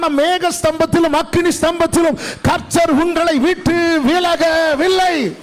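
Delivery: loud, high, slow preaching climax; thundering sermon cry; preaching shout. Real, not acted. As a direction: proclaim it with full force to the church. Preacher's climax about the axe.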